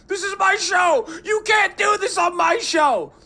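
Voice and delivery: very high, loud shouted tantrum; hammed-up high yell; mock furious host. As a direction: scream like a host losing it. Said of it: "They're like".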